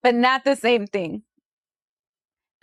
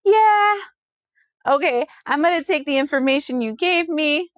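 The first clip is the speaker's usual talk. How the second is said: mock cheery; 4 semitones higher than usual. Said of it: "you're gonna be like".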